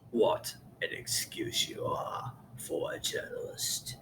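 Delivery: extremely low, hushed scolding, slowing down; slow, soft put-on British growl of disapproval; Sebastian Gorka. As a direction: imitate quiet anger sinking into a whisper. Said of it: "He talks extremely low. Let me give you an example".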